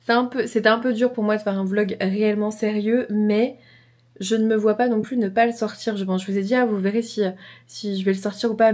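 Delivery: ordinary delivery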